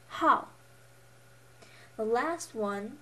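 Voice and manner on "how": "how" loud, falling sharply from high; fourth tone. Shown as a demonstration. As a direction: drop "how" firmly. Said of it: "pronunciation tones".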